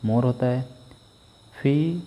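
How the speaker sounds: ordinary talk, nothing marked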